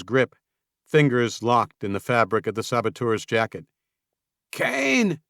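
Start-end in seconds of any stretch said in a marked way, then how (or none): 4.5-5.2 high, straining call for help; desperate shout. Acted. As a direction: shout the name out, gasping for help. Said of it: "Samuel managed to shout".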